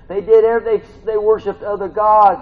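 high, loud indictment; exasperated preaching. Real, not acted. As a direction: raise the voice in exasperated disbelief. Preaching at full force.

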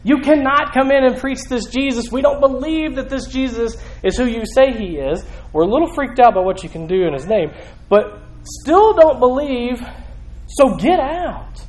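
high-pitched acted indignation; worked-up voice of offended temple authorities; indignant objectors. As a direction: refuse them entry, stretching out the final order. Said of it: "They're saying".

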